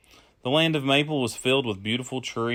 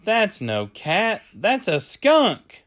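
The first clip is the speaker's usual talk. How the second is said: put-on high, louder, slow teasing kids' voice; children laughing at a skunk; 8 semitones higher, 4 dB louder, much slower than usual. A narrator's reading. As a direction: drag it out like giggling kids. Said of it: "the children said".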